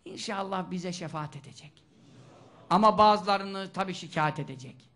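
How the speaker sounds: usual speaking voice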